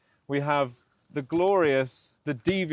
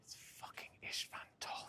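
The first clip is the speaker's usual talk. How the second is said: whispering; 24 dB quieter than usual. Real, not acted. Spotting actor, hushed to camera.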